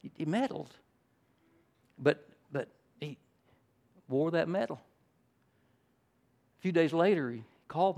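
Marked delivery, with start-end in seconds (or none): none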